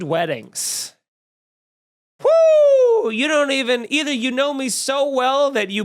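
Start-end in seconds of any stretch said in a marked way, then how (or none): 2.2-3.1 long, high-pitched loud whoop. Real, not acted. Host reacts to hearing Disney-themed weddings, loud high whoop. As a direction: let out a sustained piercing "woo".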